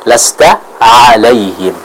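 slow "lasta alaihim" with throat ain; correct ain. Shown as a demonstration. Teacher models correct ain, slow recitation.

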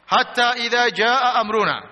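forceful measured recital; Quran recitation. Acted. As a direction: stretch each syllable of the verse. Recites scripture aloud.

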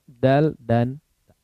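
"dal" and "ta" said slowly and carefully; drawn out so students can follow; slow letter demo. Shown as a demonstration. Teacher naming letters for students, slow and careful. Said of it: "untuk mengucapkan huruf to".

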